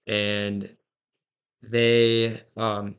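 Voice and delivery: ordinary talk, nothing marked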